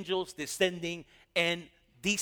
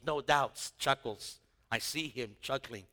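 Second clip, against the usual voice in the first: ordinary talk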